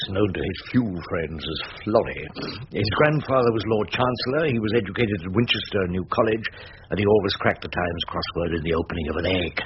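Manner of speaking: low, dry narrator voice; story narration. A narrator's reading. Rumpole recalling the judge's background, dry narration.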